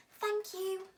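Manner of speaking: little mice squeaking their thanks; squeaky mice. A narrator's reading. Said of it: "they squeaked".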